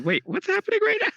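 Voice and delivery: high, fast, startled question; sudden shocked squeak; shocked disbelief. Retold or imitated. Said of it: "like, I said".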